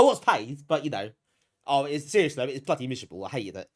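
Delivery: ordinary talk, nothing marked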